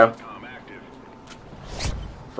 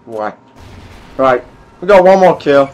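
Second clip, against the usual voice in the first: normal talking voice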